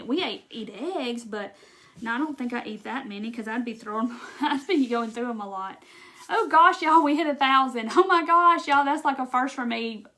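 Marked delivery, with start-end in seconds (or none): 6.3-10.0 real, gushing excitement; excited. Real, not acted. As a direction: exclaim it, thrilled at the milestone. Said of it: "that makes me so excited".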